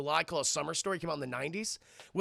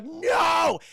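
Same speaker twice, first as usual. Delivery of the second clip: high, loud drawn-out wail; hammed-up cry of despair; anguished cry; 11 semitones higher, 9 dB louder than usual. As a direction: wail in mock horror. Hero's anguished reaction.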